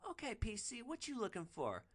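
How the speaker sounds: high, put-on street hustler voice; a working girl sizing up a customer; streetwise woman voice. Hooker to passenger, high put-on voice. Hooker greets rider from car.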